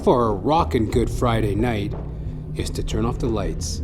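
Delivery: ordinary talk, nothing marked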